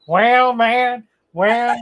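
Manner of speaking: high, loud Texas drawl opener. Acted. Lost traveler slips into a Texas drawl. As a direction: stretch the Texas "well" out big. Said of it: "I can't do Texas without doing Southern drawl".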